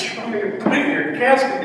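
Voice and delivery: hint of disbelief at the request; incredulous question. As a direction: son echoing his father's odd request, mock incredulous. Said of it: "I said".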